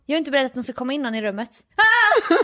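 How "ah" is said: short, high, loud gasp-scream; frightened shriek. Acted. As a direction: act out a startled yelp. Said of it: "jag är ju också rädd här hemma".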